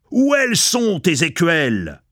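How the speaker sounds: high, menacing demand; nasty tone. A narrator's reading. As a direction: snap it nastily at the culprit. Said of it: "demanda d'un ton méchant".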